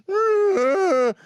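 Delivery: high loud playful elephant growl; mock animal roar for the kids. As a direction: roar like a dad playing elephant. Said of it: "he walks around the house going".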